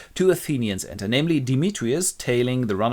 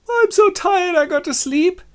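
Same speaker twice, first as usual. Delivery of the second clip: very high mock-tired whine; weary Helena; 6 dB louder than usual. Helena collapsing in the forest, weary. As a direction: wail exhaustion at high pitch.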